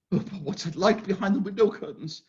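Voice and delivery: usual speaking voice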